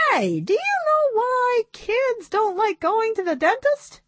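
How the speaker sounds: high puppet voice setting up a joke; high-voiced puppet comedian; monkey puppet. A narrator's reading. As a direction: pitch it up like a joking monkey puppet.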